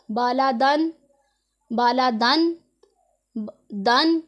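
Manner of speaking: दन with the नून sounded fully, no nasal hum; clear tanween. Shown as a demonstration. Teacher isolating the tanween sound for her students. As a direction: stress the नून in बाला दन. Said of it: "इसकी पूरी आवाज़ निकालनी है".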